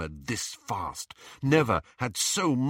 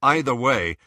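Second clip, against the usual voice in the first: usual speaking voice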